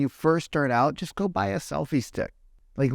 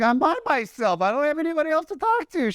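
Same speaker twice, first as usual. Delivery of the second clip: much higher, quick, put-on voice, replaying the glacier chat; exasperated mock reply; 13 semitones higher than usual. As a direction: replay your own cheeky answer, high and fast. Retelling his reply to tourist mom.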